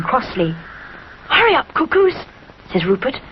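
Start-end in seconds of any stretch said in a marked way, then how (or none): 1.3-2.2 high urgent small bear; little bear voice. A narrator's reading. Rupert urging the cuckoos, high and hurried. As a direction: hurry them along like a boy.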